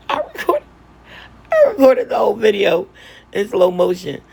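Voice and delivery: high, breaking into laughter mid-sentence; giggling through the words; laughing while talking. Real, not acted. Laughing at her slow video.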